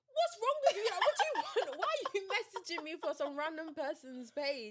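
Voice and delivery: high, loud exasperated snap; mock outraged questioning; annoyed outburst. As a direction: demand to know what's wrong, fed up. Annoyed reaction to strange call.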